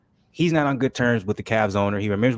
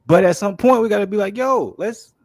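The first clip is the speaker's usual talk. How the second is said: heated; 9 semitones higher, 6 dB louder than usual. Real, not acted. Argues passionately to cohost.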